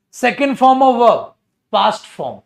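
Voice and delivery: loud, slow, teacher-drill answer; emphatic drill. Shown as a demonstration. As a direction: call it out like a class answer. (ज़ोर से रटा हुआ जवाब)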